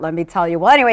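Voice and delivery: ordinary delivery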